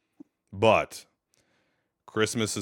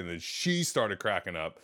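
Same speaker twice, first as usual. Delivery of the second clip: ordinary talk, nothing marked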